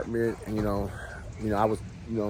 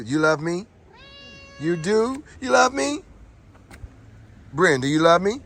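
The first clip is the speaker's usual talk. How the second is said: much higher, sing-song questions to the dog; 10 semitones higher, 4 dB louder than usual. Real, not acted. Baby talk to his dog.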